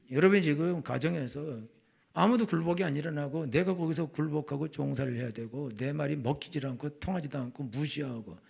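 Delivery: ordinary talk, nothing marked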